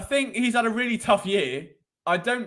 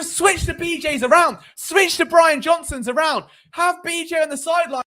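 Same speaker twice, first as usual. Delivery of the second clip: higher and louder ranting rise; carried-away shouting; 8 semitones higher, 5 dB louder than usual. Real, not acted. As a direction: get swept up and shout the idea. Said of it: "I got a bit too carried away".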